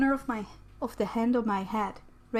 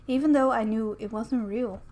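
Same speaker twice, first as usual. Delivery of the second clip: usual speaking voice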